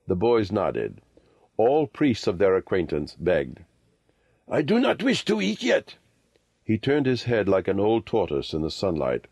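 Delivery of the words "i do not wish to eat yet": put-on high-pitched old holy man; old lama, declining. A narrator's reading. Old lama refusing food from boys, high voice.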